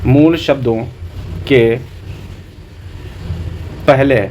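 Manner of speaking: मूल शब्दों, के, पहले parcelled out with long pauses; long gaps before के and पहले; dictation pace. Shown as a demonstration. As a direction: dictate it piece by piece for note-taking. Dictates a definition for notes.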